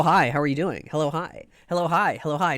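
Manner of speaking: ordinary delivery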